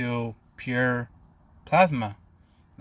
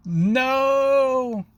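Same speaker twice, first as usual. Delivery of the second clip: high, drawn-out mock scream; screamed protest; 12 semitones higher, 5 dB louder than usual. A narrator's reading. Three classmates react to Zuko's new power, screamed protest. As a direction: wail it long and loud, high above the narration. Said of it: "screaming out".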